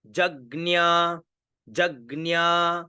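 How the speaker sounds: जग्न्या slowly, with the final vowel held level; pronunciation drill. Shown as a demonstration. Teacher repeats for students, slow drawn-out जग्न्या. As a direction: stretch जग्न्या as jag-gnyaa. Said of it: "इसको हम उच्चारण करेंगे".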